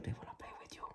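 breathy secret whisper, almost no voice. Acted. Tarot reader whispering the secret to her Leo viewers.

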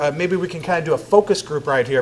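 normal talking voice

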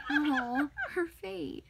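usual speaking voice

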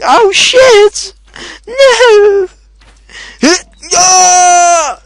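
very high, loud terrified shriek; panicked scream. Real, not acted. Gamer screaming as a monster attacks him. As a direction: scream for your life.

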